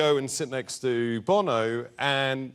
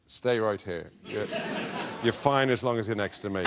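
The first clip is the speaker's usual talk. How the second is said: lower, half-hearted [name] voice; a lightly voiced, lower take on [name]; bad [name] impression; 7 semitones lower, 3 dB quieter than usual. [name] reassures the nervous comedian, mock low [name] voice. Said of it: "That's the worst [name] impression ever".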